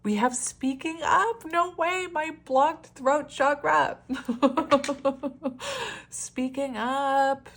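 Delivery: high, slow, laughing disbelief; excited laughing. Real, not acted. Reader laughing at the throat chakra card, excited. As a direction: marvel at the card through laughter.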